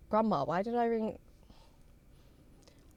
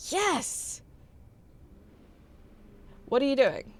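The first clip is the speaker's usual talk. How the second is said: usual speaking voice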